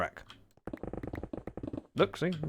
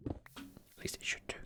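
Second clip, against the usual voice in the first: whisper; 19 dB quieter than usual. Real, not acted. Unsure aside to self.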